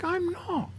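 high, small mock protest; soft squeak of denial, acting it out; retold protest. Retold protest to wife's praise.

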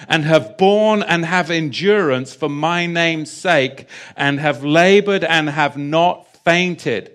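loud, measured scripture proclamation; scripture reading. Acted. Reads scripture aloud at full force.

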